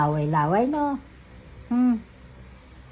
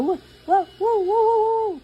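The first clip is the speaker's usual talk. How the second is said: rising higher and higher wows; 4 dB louder than usual. Real, not acted. Cheering the baby. (masiglang papuri sa sanggol, pataas nang pataas ang boses)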